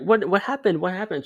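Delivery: high, pressing questions; imitating his eager younger self pestering a crush; eager asking. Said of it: "I was asking her".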